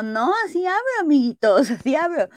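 a defiant high-pitched reply to her friends; insistent high retort. Retold or imitated. Defiende su forma de hablar. (réplica aguda y desafiante)

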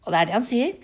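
usual speaking voice

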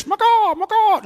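high-pitched mock secret call on "Makah"; silly signal noise. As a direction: squawk "makah" like a joke agent signal. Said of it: "I'm gonna make that noise".

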